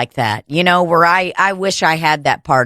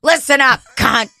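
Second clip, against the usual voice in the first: brash mock threat; 7 semitones higher than usual. Process server dropping the accent, brash mock threat. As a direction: lay down the law, pitched way up.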